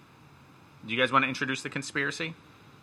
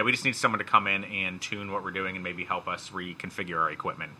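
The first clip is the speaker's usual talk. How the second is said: normal talking voice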